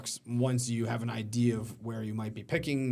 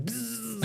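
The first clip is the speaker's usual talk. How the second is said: high buzzing zap noise; laser buzz; 10 semitones higher, 4 dB quieter than usual. Pretend. Host miming the coach's laser eyes, high buzz. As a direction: make a high-pitched laser sound.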